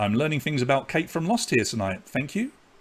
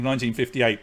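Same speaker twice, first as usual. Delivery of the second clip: usual speaking voice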